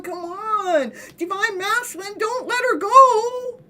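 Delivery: high, mock-tearful plea that rises to a slow cry; pleading onlookers. Onlookers urging the divine masculine, high and mock-weeping. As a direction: beg like a crowd willing the lovers together. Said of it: "and they're going".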